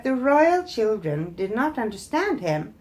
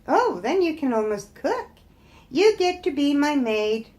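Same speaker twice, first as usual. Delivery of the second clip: higher, lightly voiced haughty prince; a touch of a pompous young prince, pitched up; Prince Pippi; 6 semitones higher, 4 dB louder than usual. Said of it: "Prince Pippi said".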